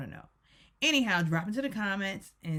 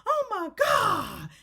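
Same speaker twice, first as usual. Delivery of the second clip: mock shocked gasp; 12 semitones higher, 4 dB louder, much slower than usual. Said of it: "And they were all like".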